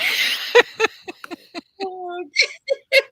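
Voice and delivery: long, high-pitched laughing fit; high peals of laughter; real laughter. Real, not acted. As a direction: dissolve into helpless laughter. Cracking up over people believing it.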